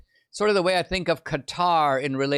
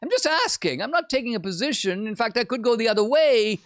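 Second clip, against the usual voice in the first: high, coy innocent voice; just-asking-questions mock; 6 semitones higher than usual. Pundit mocking Tucker as a coy questioner, higher. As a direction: feign innocence while insinuating. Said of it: "Tucker wants to be like".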